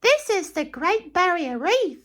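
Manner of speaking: high, sing-song guide voice; Dilly the barge. Acted. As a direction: show off the reef in a high voice. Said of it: "said Dilly".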